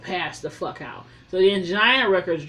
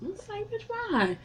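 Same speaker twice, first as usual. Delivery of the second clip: disbelief; 13 semitones higher, 7 dB quieter than usual. Real, not acted.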